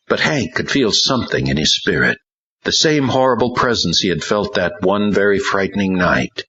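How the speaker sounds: usual speaking voice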